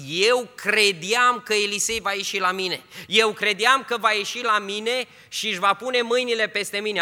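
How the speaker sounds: loud, high, offended protest; angry complaint. Retold or imitated. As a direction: voice Naaman's wounded pride, loudly. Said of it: "a plecat supărat și a zis". (Naaman supărat, cu glas tare și ridicat)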